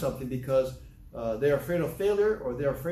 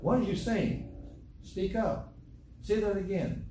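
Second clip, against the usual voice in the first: higher-pitched, puzzled asking; prompting a mumbler; 6 semitones higher than usual. Acted. Acted grandparent asking child to repeat.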